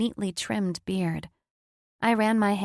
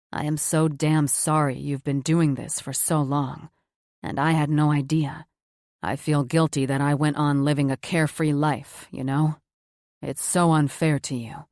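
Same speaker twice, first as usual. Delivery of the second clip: a little lower, earnest apology; lightly voiced Cam, sincere; 4 semitones lower, 3 dB louder than usual. A narrator's reading. Cam apologising to Jess, lightly lower and sincere. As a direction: say sorry from the heart, low and steady.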